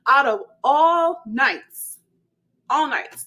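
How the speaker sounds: drawn-out, high, loud complaint; loud exasperation. Retold or imitated. Driver lamenting her bad luck that night, loud exasperation. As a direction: stretch it out in loud disbelief. Exasperated at being stuck, no police.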